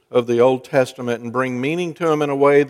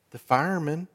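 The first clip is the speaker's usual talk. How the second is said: put-on child whispering; 9 dB quieter than usual. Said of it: "Still whispering".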